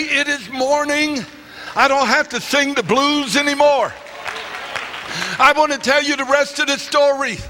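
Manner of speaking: fervent rising sermon voice; fervent preacher rousing the congregation; preaching at full force. Acted. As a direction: preach it to lift the room.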